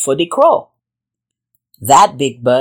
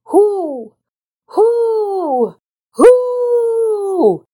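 long, high owl hoots, climbing higher; 14 semitones higher, much slower than usual. A narrator's reading. Mother Owl calls up the sun, hooting. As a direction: hoot high and hold each one. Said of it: "toward the east and hooted".

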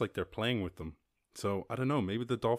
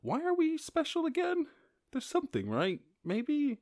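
mock wondering. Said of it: "you're like".

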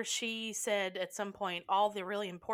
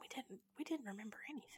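fast, much softer whisper; whispered confession; 17 dB quieter, much faster than usual. Real, not acted. Host admits to listeners they forgot everything, whispering. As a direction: whisper it like a guilty secret.

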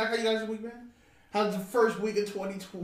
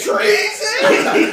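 high, loud mock cry of outrage; mock shout; 13 dB louder than usual. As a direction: yell it like a hammed-up patriot. Mock outrage at riot footage.

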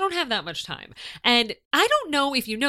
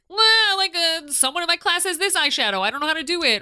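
put-on higher, louder teen asking for help; 7 semitones higher, 4 dB louder than usual. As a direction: play a clueless teen. Teen asking beauty expert.

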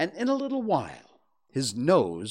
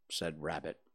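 ordinary delivery, nothing marked

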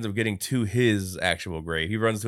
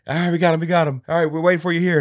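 higher and louder, calling across; 9 semitones higher, 8 dB louder, much faster than usual. Retold or imitated. In the graveyard, calling out to the runner. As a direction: call out to the others.

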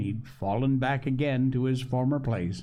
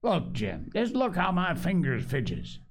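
a mild plea, lifting up on the fingers; pleading; 6 semitones higher than usual. A narrator's reading. Sick old sailor begs Jim for rum, mildly pleading. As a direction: beg Jim gently to look at his shaking hands. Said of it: "he continued in pleading tone".

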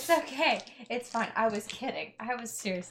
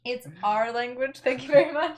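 indignant; 4 semitones higher, 8 dB louder than usual. Acted. Huffy retort about Elven.

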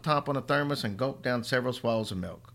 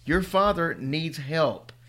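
slow, higher, louder plea; desperate plea; 6 semitones higher, 4 dB louder, much slower than usual. Acted. Mother pleading with her son, slow and desperate. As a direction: plead each word slowly.

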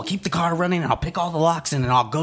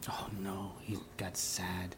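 softer hushed aside, unvoiced; whispered aside; 5 semitones lower, 17 dB quieter, slower than usual. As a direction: whisper it behind his back.

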